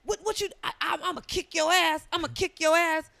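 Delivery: put-on high, loud threatening old lady; feisty old woman threat. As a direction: threaten like a cranky grandma. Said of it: "she'll be like".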